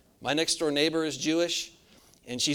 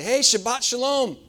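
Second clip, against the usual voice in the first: cheery greeting; 8 semitones higher, 6 dB louder than usual. Retold or imitated. Cheerfully greeting strangers at McDonald's.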